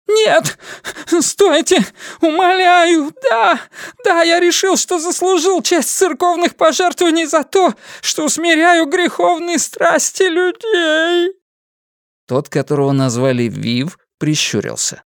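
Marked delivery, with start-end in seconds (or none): 0.1-11.3 high, frantic pleading; panicked begging. Acted. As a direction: beg desperately for mercy. Prisoner begs, confesses in panic.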